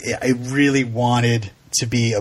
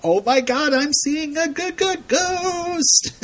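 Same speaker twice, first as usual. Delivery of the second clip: much higher, hammed-up awestruck gush; mock Patty; slower than usual. Host parodying Patty seeing the ghost, mock awe. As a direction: lampoon the mother's wonder.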